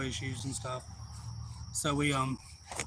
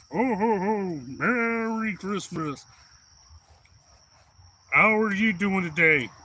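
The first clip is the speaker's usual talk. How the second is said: big, drawn-out higher ho-ho-ho voice; put-on festive character voice; Santa Claus; 5 semitones higher, 4 dB louder, slower than usual. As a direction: boom a slow, jolly greeting to children. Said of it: "went into the character of Santa".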